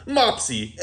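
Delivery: ordinary delivery, nothing marked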